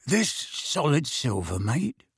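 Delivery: slow rogue's question, rising at the end; shifty Mundungus. A narrator's reading. Mundungus examining the goblet for Sirius, sly. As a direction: draw it out with sly interest.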